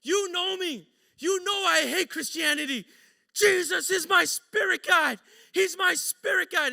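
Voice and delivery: high, worked-up insistence; frantic protest. Retold or imitated. Coworker who hates Christianity insisting to the wife, frantic.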